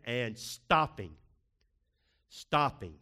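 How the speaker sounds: slow insistent stress on stopping; emphatic stopping. Shown as a demonstration. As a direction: lean hard on stopping twice. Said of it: "the art of stopping".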